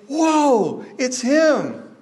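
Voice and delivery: high, slow, drawn-out whoa of wonder; stunned as it dawns on you; amazed whoa. Retold or imitated. Disciples recalling recognising Jesus, awed.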